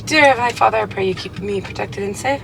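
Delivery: soft, earnest prayer under the breath; quiet prayer. Real, not acted. Asking the Lord to protect the car, hushed sincere prayer. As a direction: pray quietly for a safe drive. Prays before the drive.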